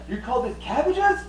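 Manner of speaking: high, scornful gripe; gate guard's complaint. Retold or imitated. Gate guard scoffing at the cabbage cart, high and scornful. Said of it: "one of the gate guards is like".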